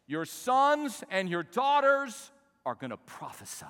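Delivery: slow, loud, high declamation; drawn-out, swelling preacher's cry; preach. Acted. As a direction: proclaim the prophecy with full pulpit force. Declaims prophecy.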